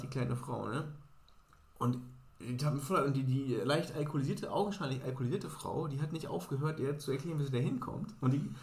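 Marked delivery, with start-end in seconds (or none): none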